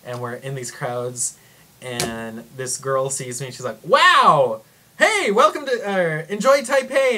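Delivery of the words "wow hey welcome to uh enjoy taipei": high, loud, gushing welcome; imitating an over-excited friendly local; excited greeting. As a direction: shout it out like a thrilled stranger. Acts out excited friendly girl greeting her.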